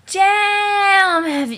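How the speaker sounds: long, loud, high yell of "Jane!". Acted. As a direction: bellow the name after a huge breath. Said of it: "Jane yelling breath".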